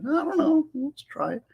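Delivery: high, put-on voice saying he'll give it a go; mock his own unsure shrug, pitched high; shrugging reply. Answering the bailiffs, high mock shrug.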